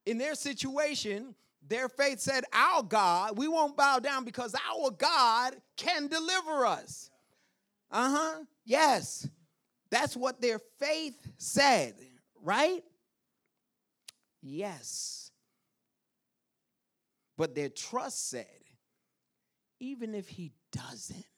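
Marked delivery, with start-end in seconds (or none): 2.5-7.1 raised, emphatic voice of faith; bold declaration. Acted. 19.8-21.3 soft, whispery resolve; hushed trust. Acted. Acts out trust's hushed answer.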